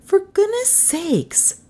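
high, drawn-out exasperated woman's voice; exasperated Melissa. Acted. Passenger scolding the driver, high exasperation.

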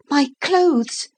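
put-on high, alarmed little girl's cry; a young girl suddenly remembering her clothes. A narrator's reading. Lucy cries out about her clothes.

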